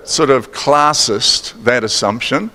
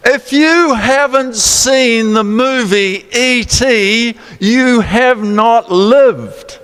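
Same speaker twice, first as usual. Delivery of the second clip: slow, higher, drawn-out insistence; emphatic urging; 8 semitones higher, slower than usual. Real, not acted. Preacher urging the congregation to watch E T, slow and emphatic. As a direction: lean in and insist they must see it.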